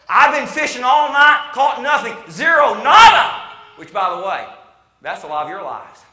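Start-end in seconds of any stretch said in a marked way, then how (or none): 0.1-3.4 loud, high cry of total failure; acted exasperation at full volume; exasperated testimony. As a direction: hammer out each word, raised and emphatic. Acting Peter's frustrated testimony of failure.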